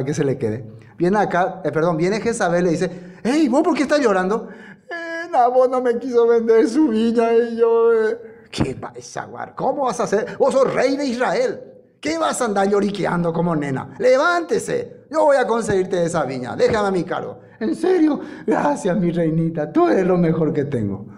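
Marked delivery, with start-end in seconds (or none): 3.2-4.5 voicing Jezabel. Jezabel voiced scolding Acab. 4.9-8.2 whiny Acab. Acted. Acab voiced whining to Jezabel. 8.5-17.4 mocking Jezabel. Jezabel voiced mocking Acab. 17.6-21.1 giddy Acab. Acted. Acab voiced, delighted and high.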